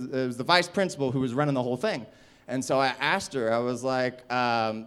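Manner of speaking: ordinary talk, nothing marked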